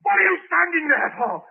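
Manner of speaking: high, loud yell to get out; furious shout. Acted. Frantic tenant shouting at the policeman, furious yell. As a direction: scream him out the door.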